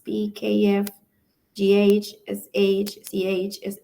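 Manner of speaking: usual speaking voice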